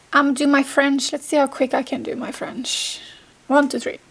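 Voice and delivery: ordinary talk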